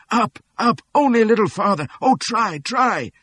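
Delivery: high, loud pleading woman's voice; Cassie crying out. A narrator's reading. Woman begging a wounded man to keep going, high and loud. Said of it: "Cassie's voice was crying".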